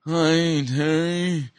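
echoing ethereal spirit voice; an airy otherworldly being, gently asking; Holy Spirit voice. Pretend. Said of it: "this is the Holy Spirit voice that I use".

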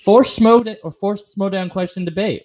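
ordinary talk

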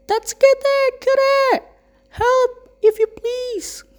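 very high, slow, strained cry for help; high, desperate but courteous plea; polite scream. A narrator's reading. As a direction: scream it politely from under the rubble. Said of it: "screamed with formal politeness".

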